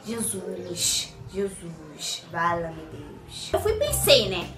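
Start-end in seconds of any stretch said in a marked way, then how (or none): 0.1-3.5 low, soft, slow hushed plea to Jesus; hushed prayer. Retold or imitated. Scared daughter praying under her breath, soft and low. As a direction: whisper a desperate prayer.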